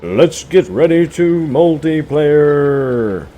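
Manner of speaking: high, loud, slow boxing-ring announcer call; announcer intro. Pretend. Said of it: "my intro could be like".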